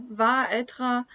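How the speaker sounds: ordinary talk, nothing marked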